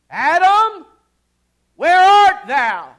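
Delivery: loud, high, slow cry of Adam's name; booming divine call. Pretend. God calling to hiding Adam, a booming cry. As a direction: call out across the garden.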